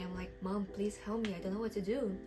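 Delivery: normal talking voice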